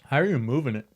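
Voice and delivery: talking with candy in mouth; eating sour candy. Real, not acted. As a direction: speak around a sour candy. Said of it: "keeping it on the tongue".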